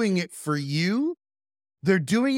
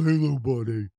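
much lower buddy voice to a dog; big dog greeting voice; 6 semitones lower than usual. Retold or imitated. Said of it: "I'd be like".

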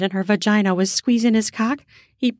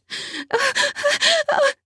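high cry. Acted. Narrator voices woman's cry.

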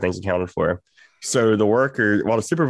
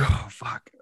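defeated, breath-only swear; whispered dismay; 5 dB quieter than usual. Retold or imitated. Worker hearing his vape is gone, whispered dismay. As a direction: whisper it as the bad news sinks in.

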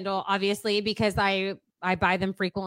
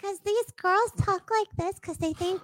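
put-on baby voice; 6 semitones higher, 5 dB louder than usual. Shown as a demonstration. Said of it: "I can also do a baby voice".

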